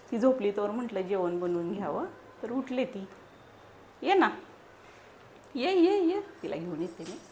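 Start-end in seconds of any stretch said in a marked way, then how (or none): 4.0-6.2 high, loud sing-song coaxing. Real, not acted. Mother coaxes baby again.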